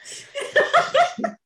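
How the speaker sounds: high rising giggle; high laugh. Real, not acted. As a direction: giggle at the awkward reply. Laughing at the doctor's reply.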